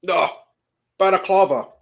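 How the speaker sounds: ordinary talk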